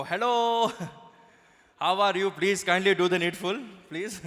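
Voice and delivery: put-on loud Indian-accented royal greeting; mock Queen with a thick Indian accent. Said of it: "never seen Queen Elizabeth go".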